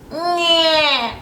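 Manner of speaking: high mocking whine; whiny cry. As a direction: whimper like a crybaby. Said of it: "I'm not gonna do the whining voice".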